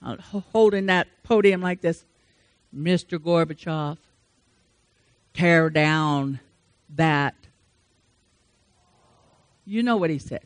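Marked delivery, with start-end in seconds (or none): 2.7-7.3 lower, measured Reagan impression; a presidential command, mellow and slow; Reagan's mellow voice. Preacher imitating Reagan at the podium, lower and mellow. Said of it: "mellow Texas voice of his".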